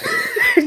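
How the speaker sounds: sudden high laugh breaking in; burst of laughter. Real, not acted. Vlogger laughing at scared Landon, high burst of laughter. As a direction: crack up mid-recap.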